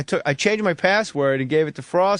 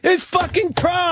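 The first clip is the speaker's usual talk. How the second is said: high, slow burst of disbelief; incredulous outburst; 10 semitones higher, slower than usual. Real, not acted. Telling a friend his rival was a kid, incredulous. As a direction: blurt it out in total disbelief. Outrage at the reveal.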